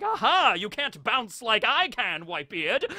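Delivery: high, slow gloating jeer; cocky sing-song taunt; taunting villain. Acted. Garling taunts while bouncing around.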